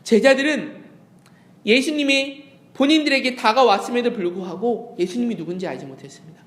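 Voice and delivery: ordinary delivery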